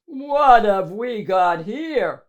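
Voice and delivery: slow, loud menacing growl; growling monster. Acted. Big monster looming over Mo, slow and growling. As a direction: growl it down at the little one. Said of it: "the big, huge monster growled".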